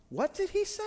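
shocked double-take at the giant's words; preacher's David catching the insult, high and incredulous; surprised. Retold or imitated.

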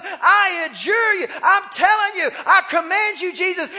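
high, loud commanding shout; blustering, hammed-up yell; yelling devil. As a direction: act out a demon shouting demands. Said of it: "When the devil yells at you".

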